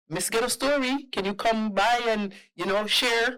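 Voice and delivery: imitated phone caller. Voicing callers inviting her.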